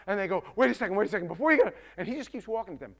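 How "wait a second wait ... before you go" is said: mock yelling. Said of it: "they start screaming and yelling at him".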